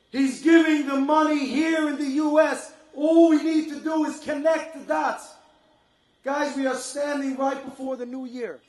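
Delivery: rally the crowd to connect the dots; fervent preaching. Acted. Fundraising appeal reaches its climax.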